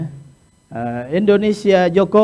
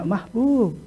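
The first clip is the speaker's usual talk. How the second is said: soft call; 5 semitones higher, 8 dB quieter than usual. Shown as a demonstration. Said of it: "Nggak ada mereka".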